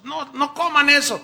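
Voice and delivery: loud, high plea to stop; urgent warning shout. Acted. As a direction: cry out to stop them eating. Everyone warns not to eat.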